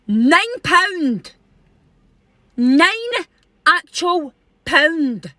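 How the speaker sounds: high, drawn-out indignant exclamation; outraged disbelief. Retold or imitated. Customer appalled at the till price, indignant disbelief.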